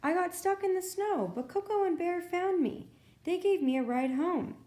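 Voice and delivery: a little fawn telling Mama the story, high; Baby Deer. A narrator's reading. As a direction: tell it all in a rush, young and thrilled. Baby Deer explains excitedly.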